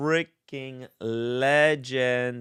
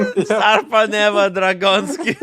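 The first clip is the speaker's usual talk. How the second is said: put-on Russian accent; 7 semitones higher, 9 dB louder than usual. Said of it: "sounds Russian".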